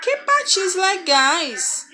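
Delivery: exclamation. Shown as a demonstration. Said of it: "Olha a entonação que a gente tem que ler".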